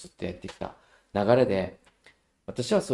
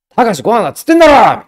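loud, rough furious shout; furious yell; 11 semitones higher, 17 dB louder, faster than usual. Retold or imitated. Mother grabbing Takashi's shoulder, furious yell. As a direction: yell it right at him, fed up.

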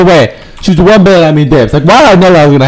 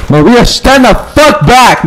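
high, forceful warning; warning shout; 10 semitones higher than usual. Real, not acted. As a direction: call out high to keep someone back. Warning Maria off.

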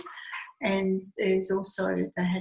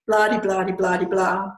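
louder, mock-bored blah-de blah-de; dismissive blah blah; 6 dB louder, slower than usual. Wives mocking their own complaints, dismissive singsong. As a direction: rattle off the moaning like it's all the same.

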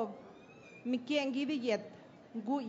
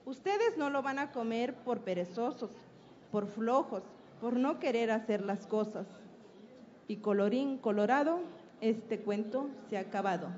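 put-on lively storytelling read aloud to children; story narration; 3 dB louder than usual. Teacher telling the hen fable to an audience, storytelling. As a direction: tell the fable like a teacher at a school event.

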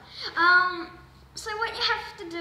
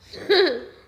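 laughing through a full mouth; muffled laugh; 10 dB louder than usual. Real, not acted. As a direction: burst out laughing, mouth stuffed.

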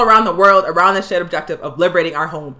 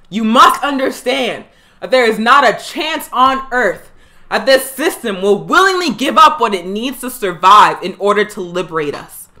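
normal talking voice

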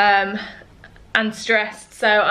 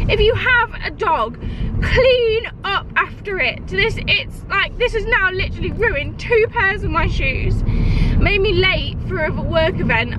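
pitched-up, slowed, exasperated scolding; furious rant; 7 semitones higher than usual. Real, not acted. Vlogger scolding dog owners to the camera, furious and high. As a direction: tell off careless dog owners, deliberately. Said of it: "I'm furious".

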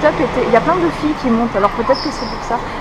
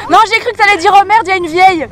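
excited; 8 semitones higher, 9 dB louder, faster than usual. Real, not acted. Laughing self-mockery at meet-up.

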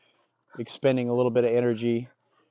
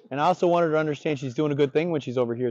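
ordinary talk, nothing marked

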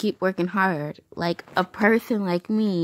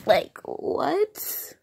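disbelieving whisper; 7 semitones higher than usual. Real, not acted. Disbelief at hundred subs.

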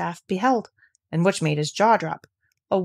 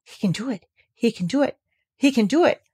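breathless gabble; 5 semitones higher than usual. Acted. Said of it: "gabbled over to herself under her breath".

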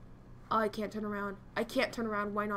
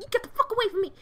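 higher, louder shout; loud outburst; 8 semitones higher, 6 dB louder, faster than usual. Acted. Repeating the line, finally yelling it. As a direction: yell it as the last repeat.

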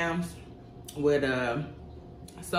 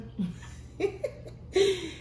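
laughing; 13 semitones higher, 4 dB quieter than usual. Real, not acted. Laughs mid song.

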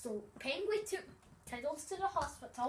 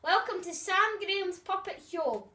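loud, high showman welcome; announcer voice; 7 semitones higher, 8 dB louder than usual. Acted. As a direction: announce it like a ringmaster. Child opening a puppet show.

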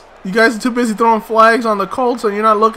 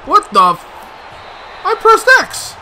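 indignant protest; 12 semitones higher than usual. Real, not acted. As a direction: insist you did it right. Protesting the controller.

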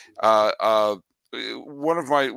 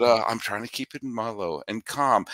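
usual speaking voice

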